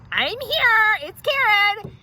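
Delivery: high, loud and entitled; shrill-free grand entrance, high and loud; self-important announcement. Acted. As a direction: announce yourself like you own the place. Announcing herself at the dentist.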